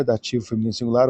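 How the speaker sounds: a quick, tossed-off repeat of the label. Shown as a demonstration. Teacher repeating the grammar label quickly to the class. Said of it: "grammar label, then a quick repeat".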